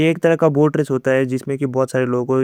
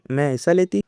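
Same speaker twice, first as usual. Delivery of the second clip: plain reading voice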